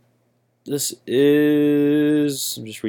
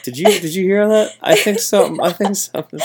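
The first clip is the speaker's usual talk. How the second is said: police officer; 9 semitones higher than usual. Retold or imitated. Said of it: "They're like".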